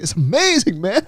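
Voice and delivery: high, imitated gushing; awed friend. Friend raving about watching the birth, imitated high awe. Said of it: "he kept saying".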